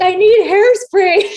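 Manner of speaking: high, drawn-out comic wail; loud protesting cry. Retold or imitated. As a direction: wail it slowly as you are held back. Protests when grabbed on the way.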